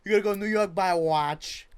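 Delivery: hammed-up Italian-American insistence; Italian-American accent. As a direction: push it hard in a comic Italian-American accent. Repeats mock Italian-American bit.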